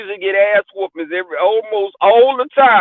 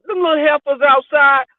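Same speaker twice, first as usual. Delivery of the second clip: lightly voiced grandparent, pitched up; grandparents' talk; 7 semitones higher than usual. Grandparents referring to little cousins, playful imitation. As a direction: imitate older folks' casual fussing. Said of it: "we talk like that".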